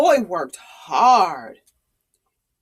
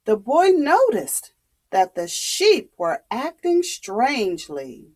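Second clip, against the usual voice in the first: ordinary delivery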